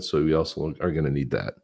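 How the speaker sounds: ordinary talk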